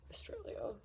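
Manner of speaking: breathy whispered afterthought; murmured under the breath; whispered aside. Real, not acted. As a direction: drop to a whisper for the country name. Whispered aside after naming Victoria.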